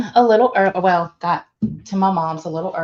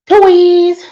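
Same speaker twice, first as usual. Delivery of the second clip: excited; 9 semitones higher, 8 dB louder than usual. Real, not acted. Excited shout-out to a viewer.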